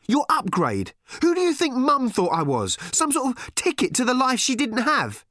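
high-pitched, scornful questions; angry demand. A narrator's reading. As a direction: fire off furious questions in a high voice. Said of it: "she demanded angrily".

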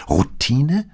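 incredulous echo, rising high; astonished. A narrator's reading. Paola echoes her husband's word about the case, astonished. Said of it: "wiederholte sie erstaunt".

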